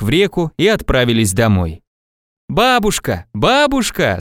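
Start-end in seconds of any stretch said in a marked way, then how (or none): 2.5-4.2 high, eager call without real loudness. A narrator's reading. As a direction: call twice from the doorway. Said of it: "с порога закричал Иван". (высокий оклик с порога)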